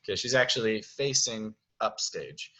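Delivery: normal talking voice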